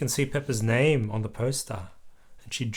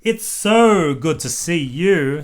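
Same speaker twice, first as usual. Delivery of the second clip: warm greeting; 4 semitones higher, 8 dB louder, slower than usual. A narrator's reading. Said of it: "said Kylie Kangaroo".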